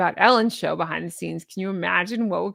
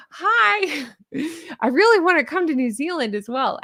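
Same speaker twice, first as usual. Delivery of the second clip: high, laughing hello to the geese; chirpy hello to geese, with a laugh; greeting New Zealand geese; 6 semitones higher than usual. Pretend. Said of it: "I'll say it".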